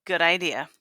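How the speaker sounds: ordinary talk, nothing marked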